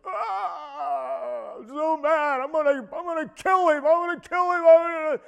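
high-pitched wailing threats, fast and worked up; imitating enraged boy. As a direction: act out a boy crying out in fury. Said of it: "Boy comes running into our cabin".

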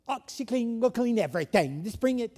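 mock Australian pitchman with a bad accent; hammed-up Australian infomercial guy; terrible Australian accent. Preacher imitating the OxiClean ad man in mock Australian. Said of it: "I do a terrible Australian accent".

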